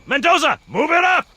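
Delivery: barked commands. Acted. Sergeant calls to Jenkins and Mendoza, shouting. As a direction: push the squad forward. Sergeant directing marines in battle.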